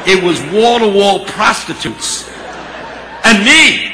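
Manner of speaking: high, booming punchline; loud comic climax. Real, not acted. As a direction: build from slow and loud to a shouted ending.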